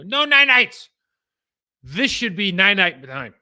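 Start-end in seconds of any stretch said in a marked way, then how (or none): 0.0-0.9 put-on high, loud toddler wail refusing bedtime; whiny toddler wail. Toddler shouting at dad that he won't go to bed, high wail. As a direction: wail it like a little kid who won't sleep. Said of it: "He go".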